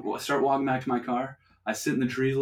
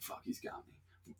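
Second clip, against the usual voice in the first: slow, breathy whisper of dread; whispered panic; 20 dB quieter, slower than usual. Retold or imitated. Hiding in the shed from the cop, slow frightened whisper. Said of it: "I'm like".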